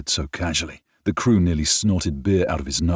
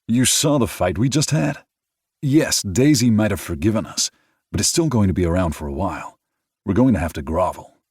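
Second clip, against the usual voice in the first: a lightly voiced friend, raised and animated; character voice, lively; 5 semitones higher, 5 dB louder than usual. A narrator's reading. Friend pointing out the family fight, lightly voiced. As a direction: speak up a bit brighter than the narration.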